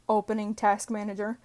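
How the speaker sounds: ordinary delivery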